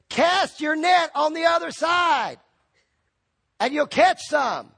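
Jesus on the shore calling to the fishermen, slowly. Retold or imitated. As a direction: call it slowly across the water.

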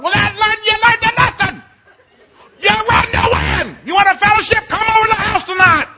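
very high, hammed-up shouting; angry lecturing. Dad rejecting his daughter's excuse, angrily shouting. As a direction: bellow the lesson at full blast.

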